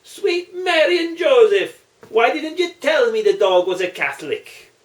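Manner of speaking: hammed-up Irish priest exclaiming in surprise; surprised exclamation. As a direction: blurt it out in sudden astonishment. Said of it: "Father Patrick exclaimed".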